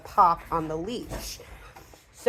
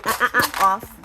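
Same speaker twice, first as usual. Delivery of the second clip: sharp "uh uh uh" then a firm "off"; correction sounds; 4 semitones higher, 4 dB louder than usual. Shown as a demonstration. Trainer demonstrating a leash correction with off. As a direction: check the dog sharply. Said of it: "So if you".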